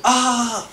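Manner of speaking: high, loud imitated yelp of panic; hammed-up freak-out cry, high; mock scream. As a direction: yelp like a cop who snapped. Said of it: "and screamed".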